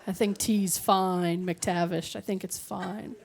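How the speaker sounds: low, slow and soft; subdued mock reassurance; understated acted reply. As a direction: trail down quietly, as if placating. Acted understated reply about the tea.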